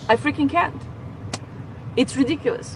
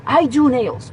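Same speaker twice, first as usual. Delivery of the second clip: ordinary talk, nothing marked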